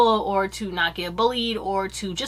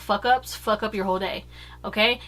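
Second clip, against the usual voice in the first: ordinary talk, nothing marked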